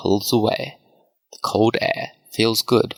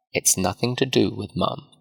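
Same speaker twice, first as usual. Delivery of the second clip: ordinary delivery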